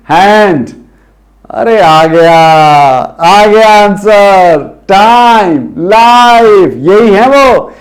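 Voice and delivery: excited. Acted. Excited answer reveal.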